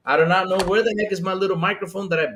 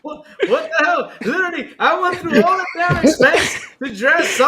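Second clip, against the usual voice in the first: words spilling through real laughter, higher and louder; laughing while talking; 7 semitones higher, 4 dB louder than usual. Real, not acted. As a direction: talk through the giggles in disbelief. Laughing about dressing up alone.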